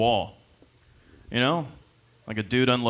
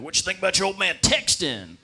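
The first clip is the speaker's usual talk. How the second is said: proud dad voice; 7 semitones higher than usual. Retold or imitated. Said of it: "He's like".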